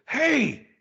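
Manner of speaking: put-on high, loud little-girl scolding; imitated scolding daughter. Daughter telling off a cursing neighborhood kid, high imitated scolding. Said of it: "I just hear my daughter go".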